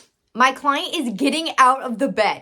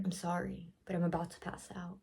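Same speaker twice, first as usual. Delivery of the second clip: much softer, as if about to pass out; weak, faint voice; 4 semitones lower, 16 dB quieter than usual. Retold or imitated. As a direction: let the words fade out like someone close to fainting. Retelling faint apology to client.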